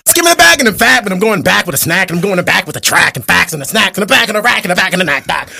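high, loud rapid-fire rap. Acted. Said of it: "[name], [name] is easy".

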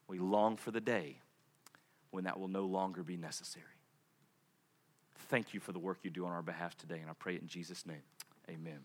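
soft, low, prayerful closing; hushed prayer. Acted. Pastor softly praying to close the sermon. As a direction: pray gently over the congregation.

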